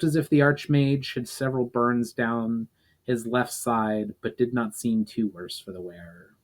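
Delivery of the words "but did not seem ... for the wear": ordinary delivery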